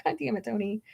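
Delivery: laughing it out, the words dropping soft; mock scold through a laugh; laughing exasperation. Real, not acted. To Tony after his Scientology joke, laughing softly.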